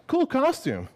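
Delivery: put-on high light girlfriend voice. Girlfriend walking in on him in the costume, high and light. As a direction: toss it off brightly.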